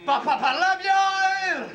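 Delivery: high, slow, laughing stammer; mocking stutter echo. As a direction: mimic the stutter, drawn out and high. Echoing partner's stammer mockingly.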